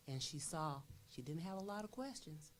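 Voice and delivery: ordinary delivery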